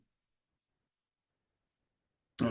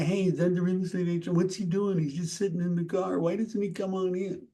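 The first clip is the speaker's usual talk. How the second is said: quick, hushed, higher-pitched wondering; gossiping homeowners; 4 semitones higher, 5 dB quieter, faster than usual. Acted. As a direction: puzzle aloud softly and quickly, imitating watchers at a window. Imagined homeowners peering out and gossiping.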